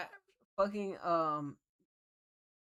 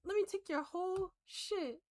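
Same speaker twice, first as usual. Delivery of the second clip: squeaky high mock grab, much higher; grabby mock voice; 14 semitones higher than usual. Streamer mocking a clip's grabby figure, high mock voice. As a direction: voice him as greedy, pitched way up. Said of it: "just be like".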